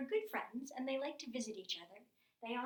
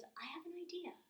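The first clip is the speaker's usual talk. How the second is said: sly whisper; 7 semitones higher, 4 dB quieter than usual. Acted. Mouse Deer's sudden scheme.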